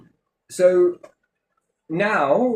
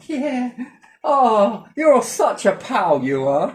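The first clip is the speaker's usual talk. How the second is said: higher, louder, slow syrupy flattery; fake friendly; 9 semitones higher, 4 dB louder, much slower than usual. Playing along with the bullies, falsely chummy. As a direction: lay the fake friendship on thick. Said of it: "just play along".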